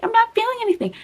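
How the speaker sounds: squeaky, high put-on friend; high mock whine. Quoting a friend at the bar, high mock whine. Friend quoted mid-drinking game.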